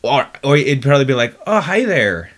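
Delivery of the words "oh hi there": loud, high Irish greeting; Irish accent. Shown as a demonstration. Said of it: "but in an Irish accent".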